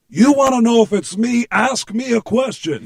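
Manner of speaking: loud, high, cocky challenge; brash demand. Retold or imitated. Prank caller pressing the doubtful assistant, brash demand.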